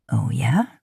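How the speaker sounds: amused low question; lightly low man's voice. A narrator's reading. Man reacts to her praise, lightly low and amused.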